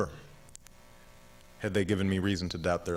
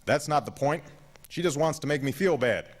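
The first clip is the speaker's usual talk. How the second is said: put-on higher-pitched, disgruntled old man's complaint; scowling grandfather; 6 semitones higher than usual. A narrator's reading. Gramps objecting to grandson's offer, scowling. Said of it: "He scowled at my suggestion".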